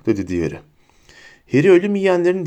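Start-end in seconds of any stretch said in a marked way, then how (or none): none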